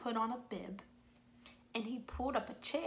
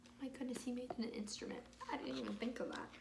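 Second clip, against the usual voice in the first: amazed whisper; 10 dB quieter, much faster than usual. Acted. Amazed aside to children.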